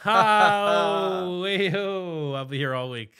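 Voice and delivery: loud, high showman voice; like a comic milking the crowd; brash vaudeville comic. Pretend. Mock comedian after whining remark.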